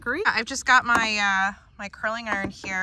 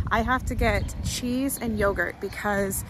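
normal talking voice

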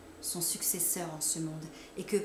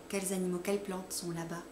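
ordinary delivery

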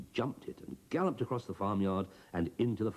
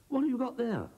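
curious broomstick voice; 4 dB louder than usual. Acted.

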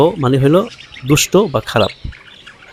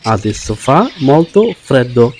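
very slow, each word laid out clearly; slow teacher demo; slow model reading; much slower than usual. Shown as a demonstration. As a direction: spell the sentence out slowly for learners. Teacher models Italian sentence.

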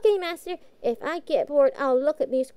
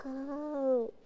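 ordinary talk

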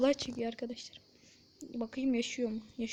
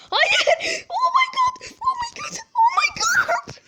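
screamed far higher, near crying; shrieking in disbelief; 7 dB louder, slower than usual. Real, not acted. Gamer watching a last-second save, shrieking oh my god. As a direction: shriek it with wild excitement.

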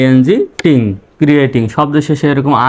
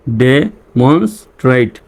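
slow repeat of "demonstrate" in chunks; teacher's slow sounding-out; syllable by syllable; much slower than usual. Shown as a demonstration. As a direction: space out de, mons, trate in "demonstrate". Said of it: "reading পড়ে আসি".

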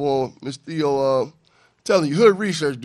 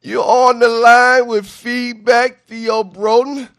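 slow, louder on-air announcer welcome; radio host greeting; 6 semitones higher, 9 dB louder, slower than usual. Real, not acted. A radio host welcoming a live caller onto the show, slow and loud announcer style. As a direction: stretch it out like a show opener.

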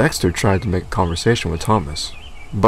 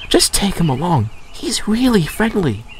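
higher, matter-of-fact message; Percy voiced; 10 semitones higher than usual. A narrator's reading. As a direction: pass on the boss's order. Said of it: "said Percy".

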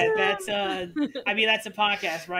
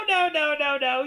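higher, louder string of no's; mock-exasperated objection, pitched up; retold protest; 6 semitones higher, 6 dB louder than usual. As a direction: protest in a rush of raised-pitch refusals. Retelling own protest to players.

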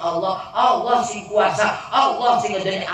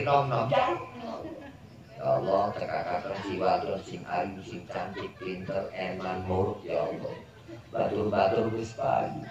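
put-on young man's prayer; 9 semitones lower than usual. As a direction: beg God sheepishly for a pretty, clever wife. Young man's prayer for a pretty wife.

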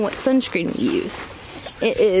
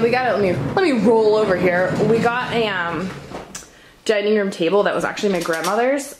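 ordinary talk, nothing marked

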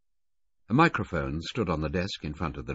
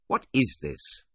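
truculent; 6 semitones higher, slower than usual. A narrator's reading. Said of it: "Filmer asked truculently".

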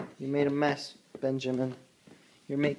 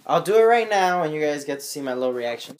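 ordinary talk

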